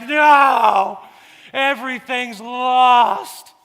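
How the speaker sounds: put-on high, loud, drawn-out toddler meltdown; hammed-up tragic child's bawl; mock weeping wail. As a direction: wail it like the world has ended. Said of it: "she's just weeping".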